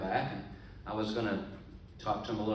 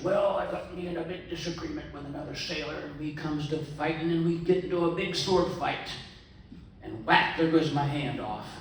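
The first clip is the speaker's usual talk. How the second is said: pirate yarn rising to a loud, higher shout; 5 semitones higher, 3 dB louder than usual. Acted. Pirate boasting of his sword fight to the man at the bar, loud and hammed-up.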